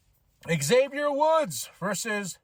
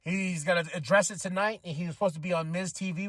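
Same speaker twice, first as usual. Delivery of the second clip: normal talking voice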